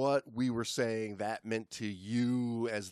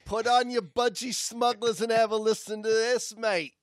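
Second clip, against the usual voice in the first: mock Australian shout; 9 semitones higher, 7 dB louder than usual. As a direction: shout it like a cartoon croc hunter. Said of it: "with our accents".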